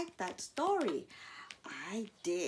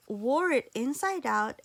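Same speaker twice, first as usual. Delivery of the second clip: plain reading voice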